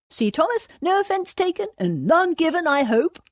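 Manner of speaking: high, nervously appeasing voice; smooth it over, pitched high; placatory. A narrator's reading. Cornelius hoping Thomas takes no offence, placatory. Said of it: "placatory grin".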